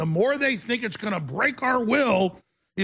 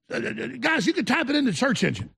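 usual speaking voice

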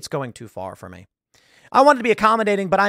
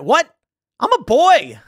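high, loud startled protest; surprised boy; 11 semitones higher, 8 dB louder, slower than usual. Retold or imitated. Young self on the phone, high startled protest. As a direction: squeak it out like a startled kid. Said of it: "And I went".

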